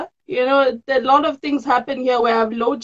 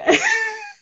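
high emotional laugh. Real, not acted.

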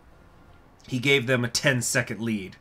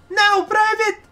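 squeaky shouted order; 9 dB louder, slower than usual. Acted. Marshal orders private.

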